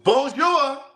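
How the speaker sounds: loud, high, sing-song "Bonjour"; a showy, exaggerated French accent; French greeting. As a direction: belt out "Bonjour" like a showy Parisian. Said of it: "Sure did".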